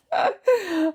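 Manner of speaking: bright high-pitched giggle. Real, not acted. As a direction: let out a quick high giggle. Giggle before reply.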